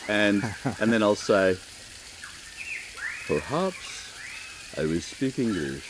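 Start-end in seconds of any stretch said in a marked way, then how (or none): 3.3-5.8 English in a Japanese accent, slow and deliberate. Shown as a demonstration. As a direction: play the Japanese industrialist switching to English. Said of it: "And then we go from there with the accent".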